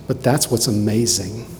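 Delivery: ordinary delivery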